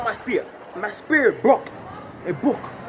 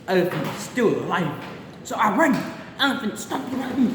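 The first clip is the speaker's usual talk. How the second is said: ordinary delivery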